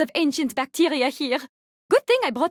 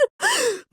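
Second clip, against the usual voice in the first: quick high gasp of surprise; short shocked gasp; startled gasp; 10 semitones higher than usual. Acted. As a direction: catch your breath at the freezing cold. Gasp at the cold.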